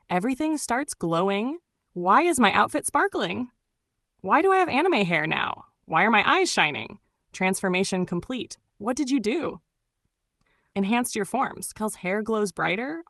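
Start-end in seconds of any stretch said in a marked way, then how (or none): none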